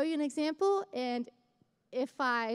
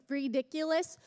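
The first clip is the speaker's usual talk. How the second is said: normal talking voice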